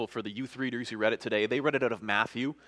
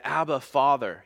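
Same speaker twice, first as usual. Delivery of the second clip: earnest, slightly raised cry to a father; 7 dB louder than usual. A narrator's reading. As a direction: read as a restrained cry out. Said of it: "he cried out".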